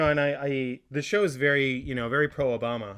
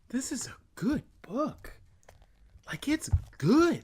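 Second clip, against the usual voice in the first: mock awe; 12 semitones higher, slower than usual.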